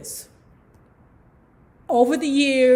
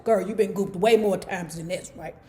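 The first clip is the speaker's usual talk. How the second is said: normal talking voice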